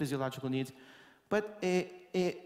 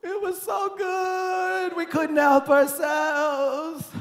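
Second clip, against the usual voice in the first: put-on high, slow, whiny kids' excuse; a light mock childish wail; imitated kids; 10 semitones higher, 5 dB louder, much slower than usual. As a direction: kids pleading they could not help it. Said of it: "voicing the kids".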